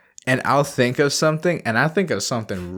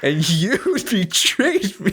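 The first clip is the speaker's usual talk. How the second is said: high, slow melodrama breaking into laughter; mock betrayed; 8 semitones higher, slower than usual. Accusing a friend who ruined the bit, mock betrayed. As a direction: accuse with hammed-up hurt, half laughing. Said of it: "you feel almost betrayed by this individual".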